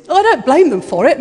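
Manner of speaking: ordinary talk, nothing marked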